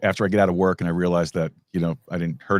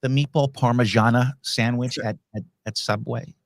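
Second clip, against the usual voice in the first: mocking local; 4 semitones higher than usual.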